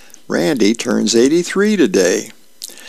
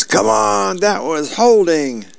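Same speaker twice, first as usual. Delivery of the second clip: high, drawn-out indignant yell; 9 semitones higher than usual. Acted. Dad protesting a call at the TV, yelling. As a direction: yell it like an outraged football fan. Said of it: "recliner and yells".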